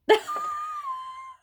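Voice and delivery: real, disbelieving shriek of laughter. Real, not acted. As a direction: let out a startled squeal of a laugh. Stunned by the card that came up.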